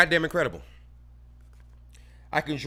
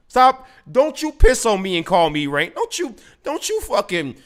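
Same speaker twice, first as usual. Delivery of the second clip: louder, higher furious snap; angry outburst; 4 semitones higher, 6 dB louder than usual. Real, not acted. Ranting at gun control supporters, furious and loud. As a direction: cut them off in real anger.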